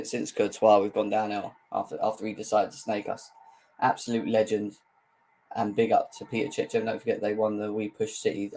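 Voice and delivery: ordinary talk, nothing marked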